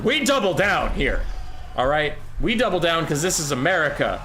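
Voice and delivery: high, emphatic mock-patriotic declaration; mock defiance. Caster defending a failed bit, mock defiance.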